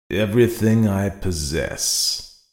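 slow, weighty promise; answered slowly. Acted. The brigand slowly offering Paolo everything, deliberate and slow. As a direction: lay each word down slowly and firmly. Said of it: "and then answered slowly".

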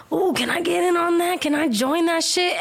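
high, put-on voice of a pushy guy; leering guy impression. One of a group of guys walking by the couple, mocking imitation. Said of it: "one of them was like".

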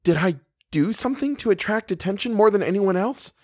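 high-pitched, begging question. A narrator's reading. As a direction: beg to know, voice pitched well up.

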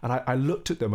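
normal talking voice

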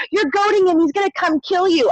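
high-pitched mock alarm; imitated freak-out. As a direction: imitate a worried team's warning. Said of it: "like, freaking out".

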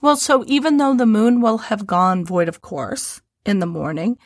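ordinary delivery

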